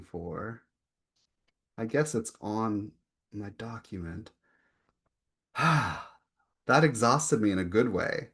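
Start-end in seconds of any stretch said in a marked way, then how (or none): 5.5-6.0 audible breathy sigh; tired exhale. Real, not acted. Poet recovering after a reading that exhausted her, tired sigh. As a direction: let out a spent sigh.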